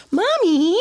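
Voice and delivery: put-on high little kid calling mommy; child's voice. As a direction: say it like a small child. Imitating the child.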